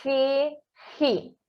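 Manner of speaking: "Re, ri" with the R rasped from the throat; throaty Spanish G. Shown as a demonstration. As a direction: say "Re, ri" like the R of Portuguese rato. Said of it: "Ele vem da garganta".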